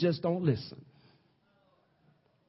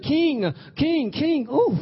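a hint of Daniel crying out high; pleading Daniel; 10 semitones higher, 4 dB louder, much slower than usual. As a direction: call the king, high and begging. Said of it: "and he said".

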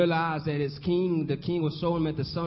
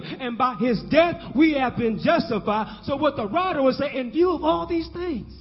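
sermon climbing higher toward a climax; building preaching; 7 semitones higher, 3 dB louder than usual. Real, not acted. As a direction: drive each phrase up like a revival preacher.

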